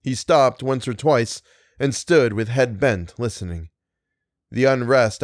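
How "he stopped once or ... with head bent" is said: plain reading voice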